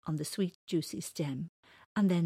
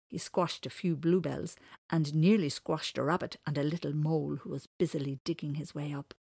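plain reading voice

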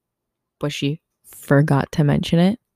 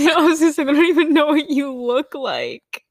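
laughing; 10 semitones higher, 4 dB louder than usual. Real, not acted. Laughing reply to friend.

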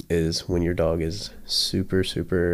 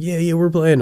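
very high, loud eager dog; 10 semitones higher, 8 dB louder than usual. Retold or imitated. Dog thinks it is joining the game, high and loud. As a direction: squeal it like a thrilled dog. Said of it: "the dog's like".

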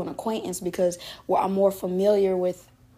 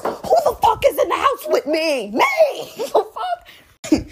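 laughing outraged shout; 12 semitones higher, slower than usual. Real, not acted. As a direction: shout it laughing, can't believe it. Outraged at mom's question.

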